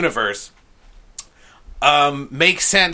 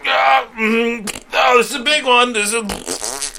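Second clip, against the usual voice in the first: straining grunt; 8 semitones higher than usual. Acted. Wizard straining on the toilet.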